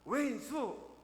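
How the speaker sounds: sudden high shout of 왼수; grandma's blurt. Retold or imitated. Grandma answers her husband on a quiz show, sudden shout. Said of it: "머뭇머뭇하던 할머니가".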